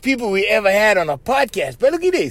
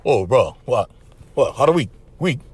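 low, laid-back monotone; cool brothers standing around, nothing bothers them; dial-tone local brother; 6 semitones lower than usual. Acted. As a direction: sparse words in an unbothered drone. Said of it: "They're like on dial tone".

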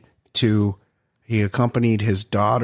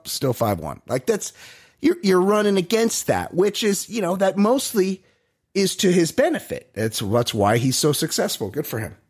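ordinary delivery

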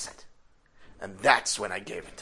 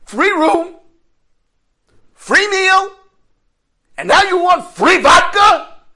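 much higher, much louder furious yell; outraged shout; 20 dB louder, slower than usual. Retold or imitated. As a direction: blast it out in disbelief at the nerve. Inner outrage at the beggar's audacity.

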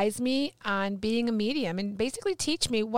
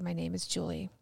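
hushed, lower, deliberate admission; emotional; 4 semitones lower, 9 dB quieter than usual. Retold or imitated. Student repeating after her advisor, quietly emotional. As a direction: say it softly and mean it. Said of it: "It was very emotional".

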